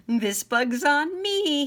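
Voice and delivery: high, loud, slow generous frog; proud frog host. Acted. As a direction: declare it grandly as the frog. Frog treats guests.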